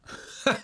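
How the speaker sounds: high breathy laugh burst. Shown as a demonstration. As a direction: let out a sudden airy laugh. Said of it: "a little bit of a demonstration".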